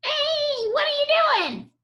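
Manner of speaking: high, squeaky little goblin; a tiny goblin catching him in the act. Acted. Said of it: "he heard a voice".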